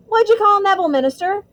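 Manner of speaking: high, loud eager question; excited. A narrator's reading. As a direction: blurt it out in amazement. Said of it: "he said excitedly".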